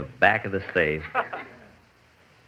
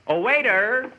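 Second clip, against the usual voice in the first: calling out; 12 semitones higher, slower than usual. Acted. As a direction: hail the waiter over the crowd. Calling out for the waiter.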